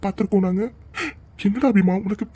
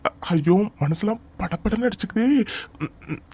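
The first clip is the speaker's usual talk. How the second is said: usual speaking voice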